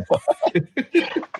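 high, laughing what; astonished laughing question; laughing disbelief. Real, not acted. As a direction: laugh out the surprise. Shocked at job offer.

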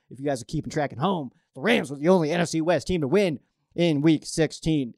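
ordinary talk, nothing marked

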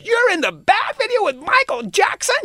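high, thrilled question like an excited fan; excited disbelief. Retold or imitated. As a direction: imitate a starstruck fan's amazement. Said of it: "he said".

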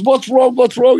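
startled flatmate asking what happened; mock concern. Mick asking the screaming Gilbo what's wrong, alarmed. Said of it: "And Mick goes".

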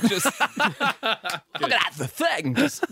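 Christopher Walken as Morgan Freeman. Acted. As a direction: break it up oddly through a laugh. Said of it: "now do Christopher Walken pretending to be Morgan Freeman".

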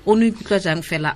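ordinary talk, nothing marked

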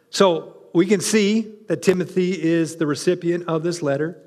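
ordinary delivery

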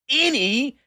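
high, loud, word-by-word declamation; exaggerated emphatic read, ANY pushed way up; all-caps reading. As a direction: blare it out as if in capitals. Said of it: "in all caps".

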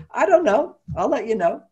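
high, breezy wife voice; a hint of a carefree wife; lightly voiced wife.

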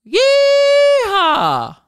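loud high yeehaw whoop; cowboy yeehaw shout. Shown as a demonstration. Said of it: "cowboy whoop ending the jingle".

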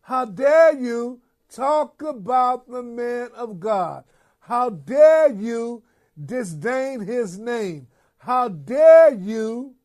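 slow, emphatic scolding, punched loud on "dare"; indignant rebuke. Acted. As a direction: rebuke them slowly, hammering each "How dare you". Acted rebuke of critics of clergy.

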